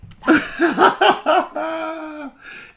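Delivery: loud, shrill laugh; high burst of laughter. Real, not acted. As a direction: crack up at the word. Laughs at ladyfingers.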